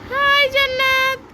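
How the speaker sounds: squeaky high toy voice; high doll voice. Pretend. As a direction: speak as a tiny doll friend. Adult voices toy friend character. (উঁচু পুতুলের গলা)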